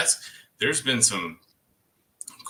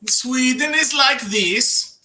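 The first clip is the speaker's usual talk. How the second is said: high, drawn-out, loud sing-song Swedish; mock Swedish accent; 14 semitones higher, 8 dB louder, slower than usual. Shown as a demonstration. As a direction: exaggerate a Swedish accent, stretched and pitched way up. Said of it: "My German sounds sort of Swedish".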